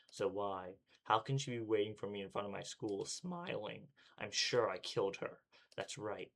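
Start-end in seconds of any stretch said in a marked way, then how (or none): none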